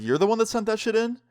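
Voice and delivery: retold disbelief, pitch leaping up high; high, astonished questioning; incredulous. Student to the girl who reported the video, incredulous. Retold surprise at ex-girlfriend's confession.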